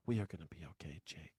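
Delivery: a sarcastic hushed whisper; mock whisper. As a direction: breathe it like a lullaby, mocking.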